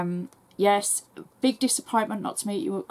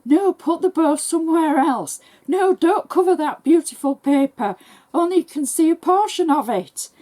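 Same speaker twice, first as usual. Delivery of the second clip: raised, high, loud protest; mock screaming at screen; 9 semitones higher, 7 dB louder than usual. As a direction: call out in exasperated pleas, much higher and louder. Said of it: "scream at the screen as well".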